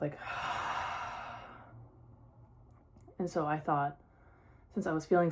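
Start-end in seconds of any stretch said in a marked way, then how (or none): 0.2-1.8 long exhale out through the mouth; big exhale. Shown as a demonstration. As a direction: sigh it all out slowly. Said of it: "a big exhale at the mouth".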